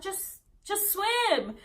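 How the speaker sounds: high, drawn-out mock command; stretched-out, exasperated impression; impatient urging. Onlookers urging her, retold.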